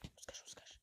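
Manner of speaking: hushed whisper, hiding. Pretend. Hiding from enemies in the game, whispering. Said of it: "pourquoi je parle comme ça".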